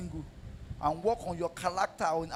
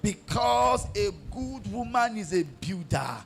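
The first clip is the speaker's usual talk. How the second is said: high, loud, shouted sermon; fiery preaching; 6 semitones higher, 7 dB louder than usual. Acted. Pastor exhorting wives to support husbands, shouted and high.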